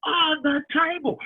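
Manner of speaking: high, very slow wailing taunt; mock taunting wail. Son taunting his superstitious mum, high mock wail. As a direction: wail it out to spook your mum. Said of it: "and I went".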